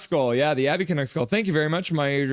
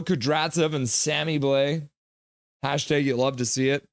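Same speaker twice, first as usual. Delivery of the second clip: usual speaking voice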